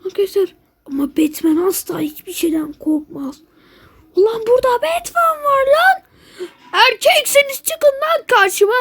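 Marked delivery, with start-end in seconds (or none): 4.1-8.7 mock tough challenge. Acting tough as Batman.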